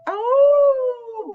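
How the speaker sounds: high, long loud howl like a wolf. Shown as a demonstration. Demonstrates a wolf sound.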